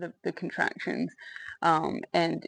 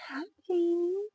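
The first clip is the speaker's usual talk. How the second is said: put-on baby talk; 12 semitones higher than usual. Greeting newborn, high voice.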